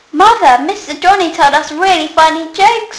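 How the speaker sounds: put-on high, loud child's call; excited scream. A narrator's reading. Children calling loudly to their mother, excited. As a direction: burst in shouting to mother. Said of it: "screamed her children".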